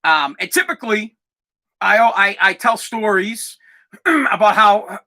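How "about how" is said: throat clearing. Real, not acted. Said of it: "the dust is killing me".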